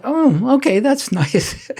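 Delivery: retold mild surprise, pitched well up; mildly surprised. Recalls his reaction to getting the part.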